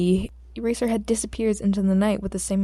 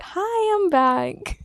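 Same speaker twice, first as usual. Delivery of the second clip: much higher, bright singsong greeting; chirpy mock Izuku; 11 semitones higher, much slower than usual. Izuku cheerily returns to the detective's office. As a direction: play the kid bouncing back in cheerily.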